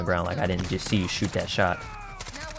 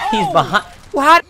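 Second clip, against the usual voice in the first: startled shriek; 11 dB louder, slower than usual. Real, not acted. Jump scare in game.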